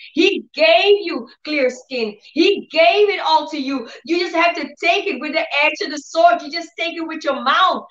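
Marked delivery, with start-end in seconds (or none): none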